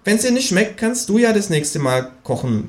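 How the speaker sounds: high, cheeky kangaroo voice. Acted. To the narrator at dinner, high cheeky kangaroo voice. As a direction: pipe up as the smug kangaroo. Känguru voice.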